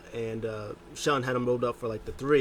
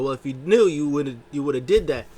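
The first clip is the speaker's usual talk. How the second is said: louder retort; mock scolding; 3 semitones higher, 7 dB louder than usual. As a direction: shoot back, fed up. MVP scolding.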